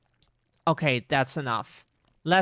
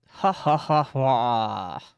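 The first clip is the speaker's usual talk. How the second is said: slow, lower evil cackle; demon laugh; 5 semitones lower, much slower than usual. Pretend. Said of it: "Probably some demon voice".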